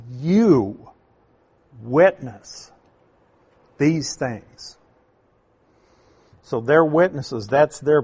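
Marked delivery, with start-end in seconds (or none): 0.1-4.8 "You" drawn out and hit loud, each word set apart; stress on YOU. Shown as a demonstration. Teacher showing the Greek verse's emphasis, stress on YOU. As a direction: punch "You", pause, then "witness", pause. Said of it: "So it's for emphasis".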